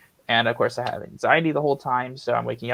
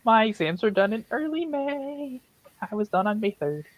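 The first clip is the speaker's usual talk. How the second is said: mocking voice; 8 semitones higher than usual. Mock voice of other students.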